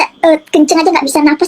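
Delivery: usual speaking voice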